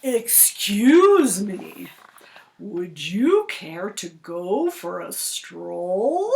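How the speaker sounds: slow, smooth fake-polite fox; sly polite fox. Fox luring the goose on a stroll, slow and sly. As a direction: sweet-talk her slowly.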